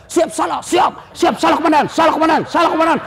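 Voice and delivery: high barked "siap salah komandan" like a scolded cop; mock shouted police salute; shouted police reply. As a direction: snap it out like a junior officer at attention.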